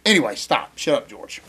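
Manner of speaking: low, soft self-scolding; reining himself in. Real, not acted. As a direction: tell yourself off under your voice. Talks himself down after rant.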